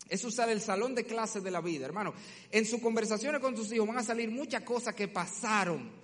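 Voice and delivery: usual speaking voice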